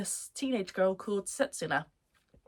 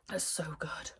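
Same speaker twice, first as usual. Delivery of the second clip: breathless whisper, barely voiced; awed whisper; 3 semitones lower, 8 dB quieter than usual. Real, not acted. Fan whispering to viewers about her favourite show, awed whisper. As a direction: whisper it like a secret you can't contain. Gushing over favourite anime scene.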